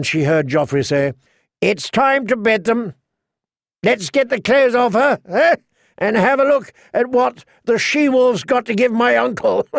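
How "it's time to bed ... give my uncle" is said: much higher, leering and gleeful; jeering boy king. A narrator's reading. Joffrey calling for the bedding before the court, high and jeering. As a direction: crow it out high to rouse the crowd.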